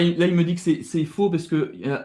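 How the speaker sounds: normal talking voice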